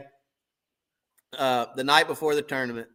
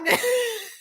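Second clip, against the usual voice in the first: high crying laugh. Real, not acted. Laughing mid-complaint.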